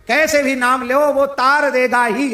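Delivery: high, loud, slow declaration; preacher's rising cry; emphatic preaching. Real, not acted. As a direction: proclaim it with full force.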